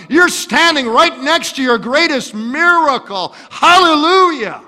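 loud, high, rising preacher's shout; full-force preaching. Acted. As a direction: build to a triumphant cry. Preaching climax.

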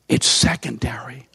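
hushed, breathy aside; dramatic whisper. Real, not acted. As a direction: whisper it to the congregation. Preacher drops to dramatic whisper.

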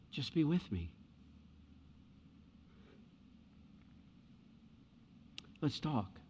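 soft, quiet and caring; Jesus softly asking to spend time together; tender Jesus voice. Acted. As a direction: speak softly, like a friend who truly wants to listen.

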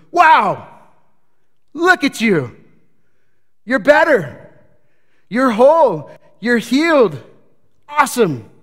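loud, high-pitched exultant cheering; elated celebration. Acted. Preacher acts Jesus delighting at the healed man, elated shouting. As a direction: whoop each short phrase up high, then let it drop. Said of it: "Quite literally, Jesus goes".

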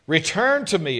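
loud, ringing plea; a booming summons to return; proclaimed. Acted. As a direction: call it out across the room. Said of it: "God's call, proclaimed".

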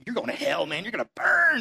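mocking condemnation that jumps way up on burn; preachy jerk scolding a friend; judgmental jerk. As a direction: play the self-righteous jerk. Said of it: "the jerk who comes up".

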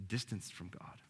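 hushed confession; whisper. Acted. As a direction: breathe it close to the listener. Quiet pastoral appeal.

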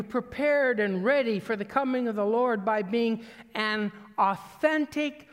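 loud, high sermon delivery; forceful preaching. Real, not acted.

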